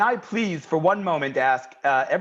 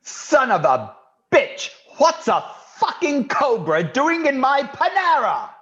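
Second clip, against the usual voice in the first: bad English accent; 10 semitones higher, 3 dB louder than usual. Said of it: "nobody does a Panera worker like [name]".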